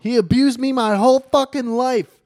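high, loud mock screaming; shrieked impression of a woman making a scene; retold scream. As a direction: imitate her screaming an accusation for all to hear.

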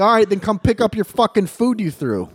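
high, loud scolding; retold angry shout. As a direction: act out yelling an order in a quarrel. Acts out quarrel in parking lot.

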